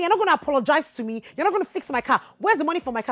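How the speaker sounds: loud, high fast demand; angry demand. Retold or imitated. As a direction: demand the money hard. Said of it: "And I'm saying".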